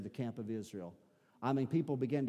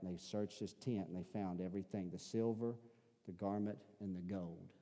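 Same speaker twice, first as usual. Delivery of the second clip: softer, lower preacher hush for the grim climax; low, soft storyteller voice for a solemn judgment; hushed sermon storytelling; 4 semitones lower, 6 dB quieter than usual. Acted. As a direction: draw them in quietly, pin-drop tension.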